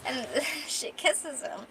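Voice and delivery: plain reading voice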